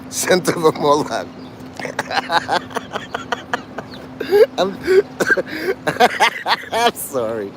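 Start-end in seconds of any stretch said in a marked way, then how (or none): 1.3-4.5 high breathless wheeze of laughter; wheezy laugh. Real, not acted. Laughing hard at his own joke, wheezing. 4.8-6.7 squealing high laugh. Real, not acted. Cracking up at the Moloch pun, squealing.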